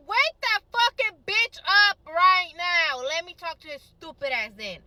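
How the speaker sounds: high, drawn-out acted demand; furious order. Acted furious order over phone.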